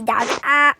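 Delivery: ordinary delivery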